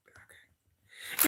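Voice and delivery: voiceless reluctant okay; whispered okay. Retold or imitated. [name] whispering a reluctant okay to his girlfriend. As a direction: breathe it out barely.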